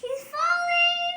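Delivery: high excited cry; excited shout. Real, not acted. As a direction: call it out, thrilled. Child exclaims at picture.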